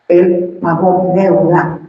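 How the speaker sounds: ordinary talk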